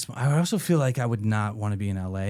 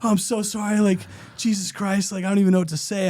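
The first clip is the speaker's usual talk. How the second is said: higher, rushed mock apology; flustered apology; 8 semitones higher than usual. Apologizing to the kicked homeless man, higher and flustered. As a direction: gush sorry like you've just hurt someone. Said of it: "I'm flustered at this point".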